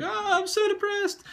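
mock his own lovesick teenage self; mopey teen. Retelling his breakup, mocking his whiny teenage self. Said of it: "And I'd be like".

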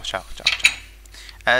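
slow, breathy, stretched-out ciao; drawn-out mock goodbye. Mocking the villager as he leaves, slow drawn farewell. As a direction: tease him with a long goodbye.

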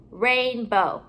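RAINBOW with a gap between "rain" and "bow"; two separate parts. Shown as a demonstration. Teacher building compound words for pupils, halves kept apart.

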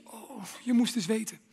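hushed secret whisper. Acted. Preacher whispering a guilty thought to the crowd.

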